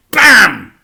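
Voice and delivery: high, loud bam; a narrator calling out a car smashing down; sound effect. A narrator's reading. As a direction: punch out the crash. Sound effect read in story.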